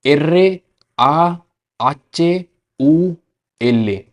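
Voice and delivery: एर्रे आ आचे ऊ एल ए, very slow, letter by letter; spelling in Spanish. Shown as a demonstration. Teacher to students, slow Spanish spelling. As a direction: spell the name slowly in Spanish. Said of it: "Spanish में बोलनी होगी".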